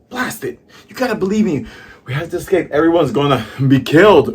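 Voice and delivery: high, loud desperate plea; desperate shout. Acted. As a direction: beg them to believe you, shouting. Usopp desperately pleading with villagers.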